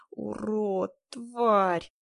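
drawn-out wail of abuse; whimpering curse. A narrator's reading. Woman cursing her absent husband, whimpering. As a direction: moan the insults, stretching them out. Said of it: "проскулила я".

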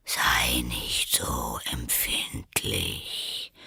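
low, slow whispery drawl; sleepy caterpillar. A narrator's reading. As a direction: contradict drowsily, half whispered. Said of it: "mit schläfriger, langsamer Stimme". (tief, langsam und schläfrig gehaucht)

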